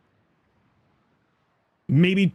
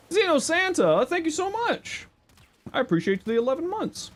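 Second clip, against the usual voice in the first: excited thanks. Real, not acted. Thanking a subscriber.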